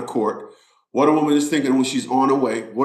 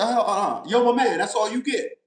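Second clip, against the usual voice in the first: higher firm "yo my man" to the cop; retold standoff; 6 semitones higher than usual. Driver telling a trooper off, firm and higher. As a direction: stop him cold, firmly. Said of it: "I said".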